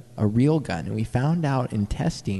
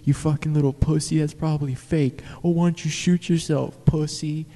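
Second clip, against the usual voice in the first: taunting; 5 semitones higher, 4 dB louder than usual. Retold or imitated. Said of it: "We're like".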